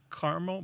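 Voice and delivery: caramel as CAR-mul; two-syllable caramel. Shown as a demonstration. Host sharing his own habit, caramel as CAR-mul. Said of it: "I think I say".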